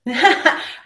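bright laugh of delight; short high laugh; real laugh. Real, not acted. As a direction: burst out laughing.

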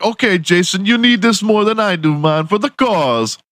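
put-on high, friendly Jamaican-accented offer; willing villager. Villager giving Jason his truck, high mock Jamaican accent. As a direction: hand over the truck gladly. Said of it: "they're like".

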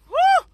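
quick high "woo"; high whoop. Real, not acted. Preacher punctuating a point, high whoop. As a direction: let a short whoop slip out.

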